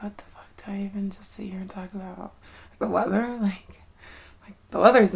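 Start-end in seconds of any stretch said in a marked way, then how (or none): 0.0-2.3 hushed, much softer whisper; a soft, embarrassed whisper. Real, not acted. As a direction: whisper it like a secret.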